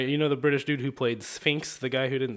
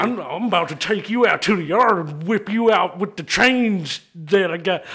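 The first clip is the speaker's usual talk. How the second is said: higher, louder Texan threat; toothy drunk Texan; 4 semitones higher, 6 dB louder than usual. Acted. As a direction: threaten through the teeth like a Texan biker. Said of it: "get real toothy with it".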